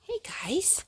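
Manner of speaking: soft whispered aside. Real, not acted. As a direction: whisper it so as not to disturb. Aside to the children.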